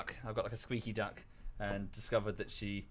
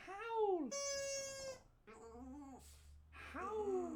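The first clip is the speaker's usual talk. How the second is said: a high, long howl for the dog to copy; howling demo; 10 dB quieter than usual. Shown as a demonstration. Owner howls high to get Lulu to copy. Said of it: "Copy the duck".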